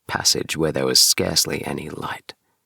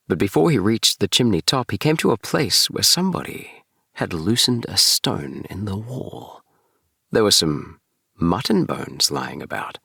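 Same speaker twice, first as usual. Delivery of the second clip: plain reading voice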